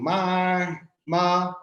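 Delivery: "ma" drawn out high and level. Shown as a demonstration. Lecturer recapping Mandarin tones on a graph, "ma" in high tone. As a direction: hold each "ma" on a high, steady pitch. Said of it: "This is the high tone".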